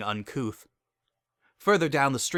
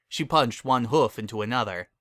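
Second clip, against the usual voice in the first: usual speaking voice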